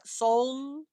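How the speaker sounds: "Soln" drawn out, L running into N; slow "Soln" with blended L and N; L-N together. Shown as a demonstration. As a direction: hold "Soln" long with the L sounded before the N. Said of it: "L N comes together".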